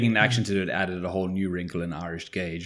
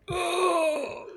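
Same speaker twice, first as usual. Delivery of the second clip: high, squeaky cringe; a pained, high-pitched groan of dismay; cringing oh no; 3 dB quieter than usual. Retold or imitated. Cringing at a South African actor.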